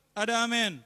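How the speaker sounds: loud, ringing call for "Ada amin"; a preacher's rousing "Ada amin"; loud call for amen. Real, not acted. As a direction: throw "Ada amin" out to the whole hall. Asks congregation for amen.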